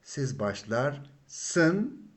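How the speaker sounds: siz başlarsın stretched out very slowly; very slow demo. Shown as a demonstration. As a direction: drag out siz başlarsın for students. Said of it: "Then it, it changes into".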